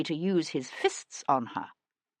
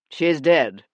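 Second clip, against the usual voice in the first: "dead" said flat and falling, louder; louder, curt announcement; blunt statement; 2 semitones lower, 6 dB louder than usual. Acted. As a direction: drop it hard and cold. Actor states the death bluntly.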